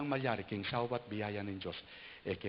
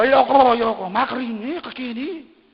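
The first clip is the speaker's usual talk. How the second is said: very high, loud shrieking refusal; refusing bride; 13 semitones higher, 19 dB louder than usual. Retold or imitated. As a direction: squeal no like a bride. Voicing bride refusing kiss. (matinis na pagtanggi ng nobya)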